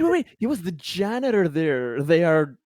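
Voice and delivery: ordinary delivery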